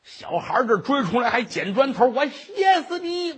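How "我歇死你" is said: high, drawn-out yelling threat; a kid screaming after the monk; boy's furious yell. Acted. Boy throws brick shouting. (孩子尖声怒喊)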